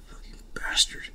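hissed under the breath, unvoiced; whispered curse. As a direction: curse him quietly to yourself. Boss curses Mineta under breath.

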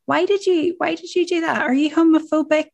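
high, questioning mock challenge; asking a friend why they said that, high and questioning; imitated pointed question. Acting asking someone directly.

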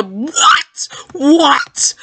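high-pitched incredulous outcry; stunned, squealed question; shocked disbelief. Real, not acted. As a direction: cry out in sudden shock. Shocked by what game shows.

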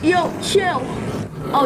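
usual speaking voice

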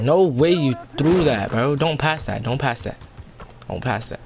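ordinary delivery

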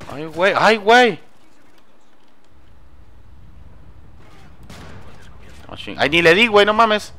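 panicked. Real, not acted. Panicked firefight miss.

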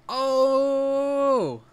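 long, loud, high-pitched whoop of "Oh!"; Regular Show-style "Oh!". Retold or imitated. Game player goofing in a cartoon's style, drawn-out high "Oh!". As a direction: hold a big high "ohhh" at full volume. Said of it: "Sorry for the regular show style".

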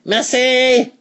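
high, drawn-out call to a daughter; mother calling her child. Acted. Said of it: "the wife called their daughter".

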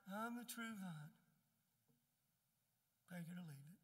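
casual mock take-it-or-leave-it delivery; offhand, indifferent. Shown as a demonstration. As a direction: toss it off like it hardly matters. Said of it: "It wasn't like".

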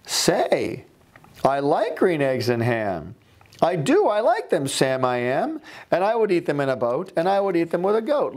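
high, delighted voice of a convert; gleeful storybook character finally trying the food; the grumpy old man won over. Retold or imitated. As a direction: sing out his new love of green eggs. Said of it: "And he tries them and he goes".

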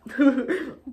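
a short jeering laugh; mocking laugh. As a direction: laugh at him, rising. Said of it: "the animals all laughed".

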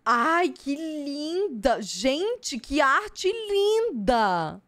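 high, slow, swooning praise; drawn-out squeal of admiration; gushing delight. Real, not acted. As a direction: melt over the card art. Gushing over a pretty card.

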